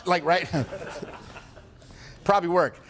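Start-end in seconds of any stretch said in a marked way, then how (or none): none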